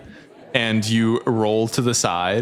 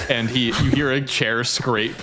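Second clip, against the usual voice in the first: usual speaking voice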